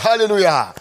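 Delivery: ordinary talk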